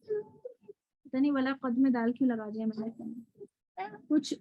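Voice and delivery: usual speaking voice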